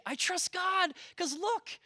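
high, sing-song voice of easy faith; smug fair-weather believer. Retold or imitated. Preacher mocking complacent churchgoers, high sing-song. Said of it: "it is easy to say".

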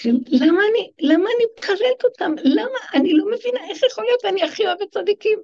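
high, fast, half-laughing bewildered plea; distraught student. Retold or imitated. Student pleading with her teacher, high and fast in bewildered half-laughter. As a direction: play the student who can't believe herself, pitch way up.

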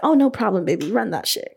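breezy, self-assured reply; confident. Retold or imitated. Applicant replying to a credit check, confident. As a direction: answer like it's already in the bag. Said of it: "I was, like, already confident".

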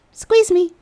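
high, loud playful plea; flirty squeeze request. Retold or imitated. Asking the handsome man in the tub, high playful coo.

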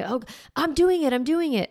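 put-on a young child rushing to comply; eager little kid. Child quickly complying, voiced by mom.

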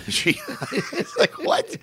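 笑いが止まらず高く裏返る「What?」; high squeaky laugh through the words. Real, not acted. Laughing while retelling the joke.

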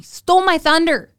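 high, loud indignant outburst; mock outrage. Indignant complaint about being upstaged.

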